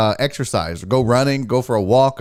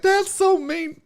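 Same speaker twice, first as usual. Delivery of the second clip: high-pitched mocking quote; mock objecting viewer; slower than usual. Viewers objecting to lizard's fate, high mocking imitation. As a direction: mimic a complainer, voice way up. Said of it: "some of you are like".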